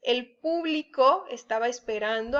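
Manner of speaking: "público" stressed on PÚ; stress on first syllable. Shown as a demonstration. Teacher showing accent on the U, "PÚ-blico" stressed early. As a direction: hit the U at the start of "público". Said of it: "lleva acento en la, en la U".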